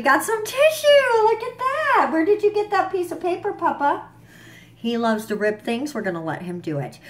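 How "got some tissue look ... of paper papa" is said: much higher, playful voice to a little one. Real, not acted. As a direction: coo at a small creature ripping paper. Speaking to a pet or baby.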